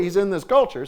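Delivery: ordinary talk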